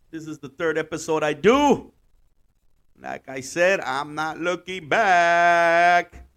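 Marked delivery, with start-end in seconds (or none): 3.8-6.2 dramatic catchphrase. Pretend.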